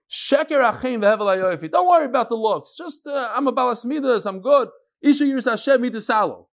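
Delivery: high, bright-voiced girl pleading her case; mimicking a plain girl. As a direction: play the girl talking herself up, pitched high. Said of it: "she would say".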